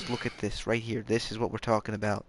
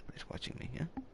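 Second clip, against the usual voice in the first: whisper; 6 semitones higher, 13 dB quieter, faster than usual. Acted. Whispering aside to viewers.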